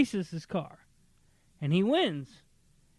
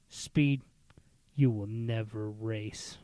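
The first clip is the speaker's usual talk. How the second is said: stern dad; 8 semitones lower, slower than usual. Retold or imitated. Stern dad voiced in story.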